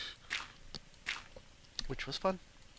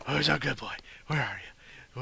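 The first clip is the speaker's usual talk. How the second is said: pet talk; 3 dB louder than usual. Pretend. Calling the wolf dog.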